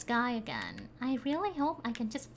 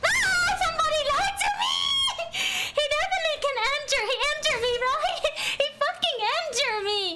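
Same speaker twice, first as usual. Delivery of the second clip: laughing outrage; 8 semitones higher, faster than usual. Real, not acted. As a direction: shriek in disbelief while cracking up. Enemy breaks in, laughing outrage.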